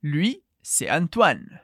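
"Lui, c'est Antoine" said very slowly and clearly; slow teacher model; slow demo phrase. Shown as a demonstration. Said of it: "E como dizemos, ele é o Antoine?".

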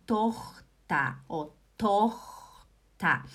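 "Tochter" slowly in two syllables, open O, final "ta"; drawn-out pronunciation demo. Shown as a demonstration. Teacher showing students the O in "Tochter", slowly split. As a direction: stretch "Tochter" as TOCH-ta. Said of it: "A minha língua fica aqui embaixo quando eu falo O".

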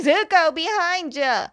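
high, urgent shout-out; mock panicked warning. Friends warning Zuko about Azula behind him, freaking out. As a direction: cry out a warning in mock alarm. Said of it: "they freak out".

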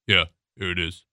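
low, offhand delivery man; indifferent driver. Acted. Driver who stays in his truck, indifferent. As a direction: answer low and unenthused. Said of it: "And he says".

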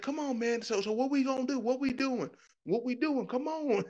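high-pitched mock effeminate impatience; effeminate angry guy.

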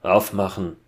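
loud, brief call. A narrator's reading. Hunter at the door demanding entry, calling out. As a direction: call it out as an order. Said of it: "rief eine Stimme".

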